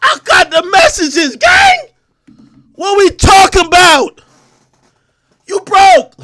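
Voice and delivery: loud and high, jeering; shouted taunt. Real, not acted. Taunting a rapper, a loud jeering shout. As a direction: yell the taunt with a sneer. Heated rant roasting a rapper.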